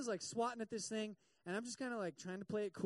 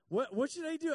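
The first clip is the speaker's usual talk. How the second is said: higher and louder, flustered; unsure and frightened; panicked question; 7 semitones higher, 8 dB louder than usual. Retold or imitated. A wife asking her swelling husband what to do, panicked.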